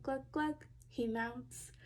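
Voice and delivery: ordinary delivery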